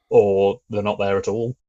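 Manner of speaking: usual speaking voice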